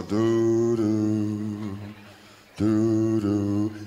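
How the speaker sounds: loud, low, plodding doo; monster footsteps. Retold or imitated. The slow movie monster lumbering along, low loud doos. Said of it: "The motherfucking monster go".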